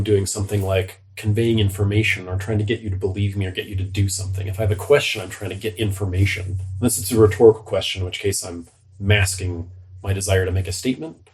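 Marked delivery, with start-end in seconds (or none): none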